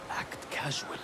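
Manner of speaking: unvoiced whisper, very soft; whispered aside. Informant sneaking the assassin out back, whispering. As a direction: breathe it out so only he hears.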